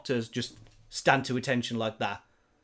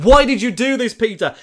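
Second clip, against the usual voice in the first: much higher and louder, a mock tearful plea; crying Aunt May; 8 semitones higher, 11 dB louder than usual. Aunt May crying over Peter in his vision, mock tearful plea. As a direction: wail the question through imitated tears. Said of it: "Aunt May, crying".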